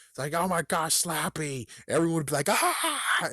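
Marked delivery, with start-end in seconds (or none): 0.3-1.7 high-pitched acted alarm; hammed-up startled cry; mock panic. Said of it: "a little bit of a little panic". 2.5-3.3 high mock scream; short high-pitched cry of fright; panicked scream. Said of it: "a little bit of a little panic".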